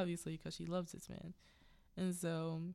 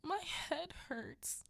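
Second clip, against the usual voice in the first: wheedling hurt husband; 8 semitones higher than usual. Retold or imitated. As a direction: play up the injury to win her sympathy. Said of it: "He says it just like that".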